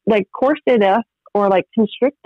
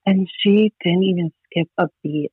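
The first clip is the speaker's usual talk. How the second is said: ordinary talk, nothing marked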